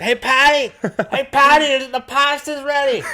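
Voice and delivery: very high, loud mock mom shouting up the stairs; shrieking caricature of a mom calling her kid to dinner; mom yelling downstairs. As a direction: friend imitates his buddy's mom hollering that pasta's ready. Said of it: "I just imagine your mom coming down the stairs".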